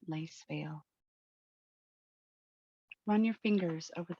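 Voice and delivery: soft, even meditation voice with long pauses; unhurried, hushed visualization narration; guided meditation. Acted. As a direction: guide listeners gently into calm, leaving space between phrases.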